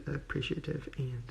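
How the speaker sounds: normal talking voice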